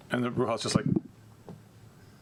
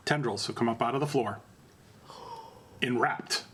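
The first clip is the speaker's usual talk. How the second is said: usual speaking voice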